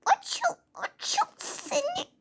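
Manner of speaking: high, slow whining plea; whiny plea. Acted. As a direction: beg the lid to open in a high whine. Struggling to open something.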